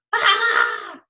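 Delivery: high startled yelp; the crowd jumps in fright; startled cry. Acted. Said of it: "the whole crowd freezes".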